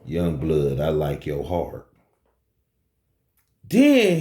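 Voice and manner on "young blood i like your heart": low, cool hustler voice; lowered dealer voice. Retold or imitated. Dealer repeating his compliment to the bouncer, low and cool. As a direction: lower and cooler, a dealer's calm approval. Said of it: "he said to me".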